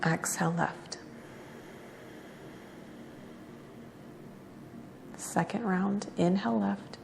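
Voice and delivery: soft, unhurried yoga-teacher cues; guided breathing meditation. Real, not acted. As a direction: guide each breath quietly, with long pauses between cues.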